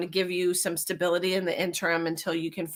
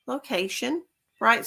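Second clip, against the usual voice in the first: usual speaking voice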